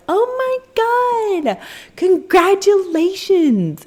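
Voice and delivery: high, slow, overjoyed mock staff voice; gushing congratulations. Birth center staff congratulating the pregnant caller, high and gushing. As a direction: ham up a beaming receptionist.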